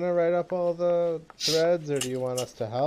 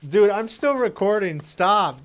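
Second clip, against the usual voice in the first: louder, higher protest; laughing protest; 7 semitones higher, 6 dB louder, slower than usual. Real, not acted. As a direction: protest loudly but playfully. Protesting a friend's joke.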